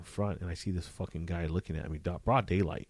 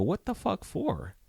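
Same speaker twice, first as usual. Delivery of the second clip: slow, high-pitched indignant question; indignant disbelief; 11 semitones higher, slower than usual. Retold or imitated. Officer reacting to a subpoena at his home, indignant disbelief. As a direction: push back, baffled and annoyed. Retold reaction to being served.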